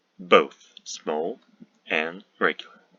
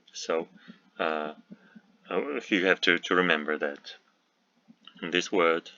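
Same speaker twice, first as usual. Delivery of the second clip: ordinary talk, nothing marked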